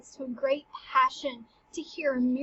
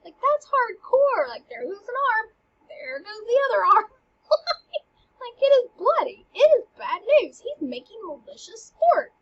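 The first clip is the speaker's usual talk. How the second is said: excited retelling; 8 semitones higher, 6 dB louder than usual. Real, not acted. Excited retelling of gruesome scene.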